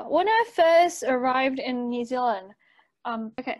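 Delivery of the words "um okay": ordinary talk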